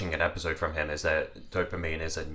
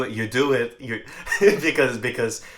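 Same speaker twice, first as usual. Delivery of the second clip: laughing; 5 semitones higher, 6 dB louder than usual. Real, not acted. Laughing mid-sentence.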